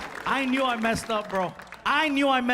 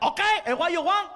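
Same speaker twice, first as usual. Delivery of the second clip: put-on impatient waiter in a Cuban accent; annoyed Cuban waiter; 8 semitones higher than usual.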